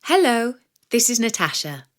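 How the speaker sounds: usual speaking voice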